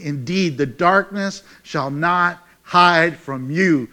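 normal talking voice

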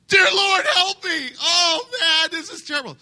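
high, loud, crying mock plea; begging God in mock despair; desperate plea. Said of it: "That's a plea of desperation".

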